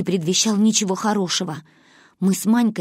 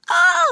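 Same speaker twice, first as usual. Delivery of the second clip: very high yelping cry; pleading cry; 5 dB louder than usual. A narrator's reading. Girl yelping under icy water. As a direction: yelp at the cold water. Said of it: "взмолила Манюня".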